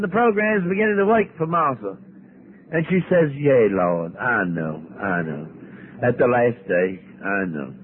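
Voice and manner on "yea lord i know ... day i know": accepting. Acted. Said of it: "And she's accepting".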